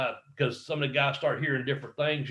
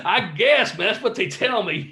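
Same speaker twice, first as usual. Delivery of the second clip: high, loud reply through laughter; laughing retold answer; 6 semitones higher, 3 dB louder than usual. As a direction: laugh through a helpless shrug.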